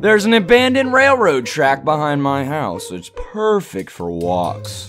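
low-pitched storytelling; story narration. A narrator's reading. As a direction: read it like an ominous tale. Reads game story aloud.

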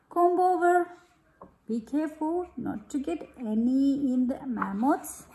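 gentle storybook reading, easy pace; reading to children. Acted. Mother reading a bath-time picture book to her child, gentle storybook voice. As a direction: read it aloud to a little one.

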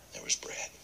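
a quiet, dramatic whisper; hushed narration. A narrator's reading. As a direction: whisper it for effect.

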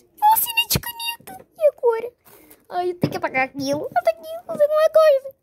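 high, rising panic; high panicky fretting; panicked. Acted. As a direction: fret over the signed paper in a high voice. Panics about signed paper.

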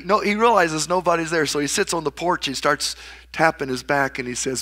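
usual speaking voice

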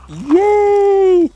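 very high, squealed cheer; real burst of glee; gleeful cheer. Real, not acted. Cheers finding the sheep.

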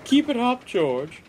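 high, loud, cheeky call; cheeky toot. A narrator's reading. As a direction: toot it out teasingly. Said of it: "tooted Caroline cheekily".

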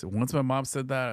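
normal talking voice

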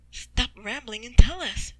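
loud, slow, firm demand; impatient order. Acted. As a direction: cut off the chatter forcefully. Tuval impatient with rambling.

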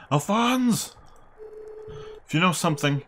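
high, loud demanding call; demanding shout. Acted. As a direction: shout his name, demanding. Geddy demands answers.

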